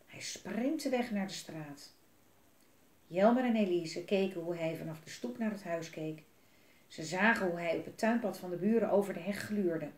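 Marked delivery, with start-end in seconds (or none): none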